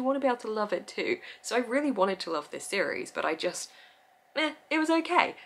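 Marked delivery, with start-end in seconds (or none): 4.3-5.4 lukewarm. Real, not acted. Lukewarm on a friend's series.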